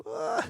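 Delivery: high squeaky groan of disgust. Retold or imitated. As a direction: let out a high ugh. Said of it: "And they go".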